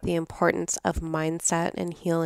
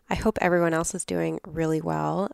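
ordinary talk

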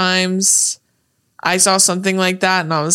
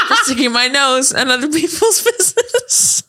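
high, laughing imitation; pitched up and breaking into laughter; laughing movie quote; 6 semitones higher than usual. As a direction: quote it while cracking up. Acting out movie quote, cracking up.

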